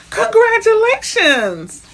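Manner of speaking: loud, high, drawn-out congratulations; joyful shout. Real, not acted. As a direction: cheer it out slowly. Viewer just bought a house.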